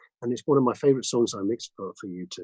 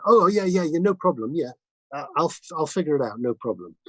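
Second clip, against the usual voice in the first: high, over-eager mock assurance; feigned confidence; 4 semitones higher than usual. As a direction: cover confusion with eager agreement. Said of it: "I didn't wanna look, sound confused".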